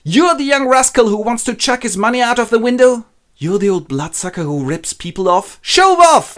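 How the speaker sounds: high, loud indignant accusations; outraged quarrel. Acted. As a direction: hurl insults, rising to a yell. Father and son trade insults.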